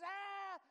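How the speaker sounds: loud high "ah" of being caught out; retold outcry. Preacher retelling a failed test, loud outcry. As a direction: cry out as if catching a slip.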